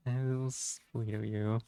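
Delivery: normal talking voice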